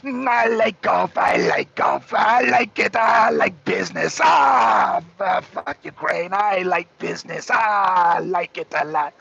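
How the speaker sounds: lightly voiced Trump, slow, with bursts of shouting; a loose hammed-up Trump, chanting his likes; mock Trump. Streamer mocking Trump's talks with Putin, loose Trump voice. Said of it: "That's what Trump is doing right now".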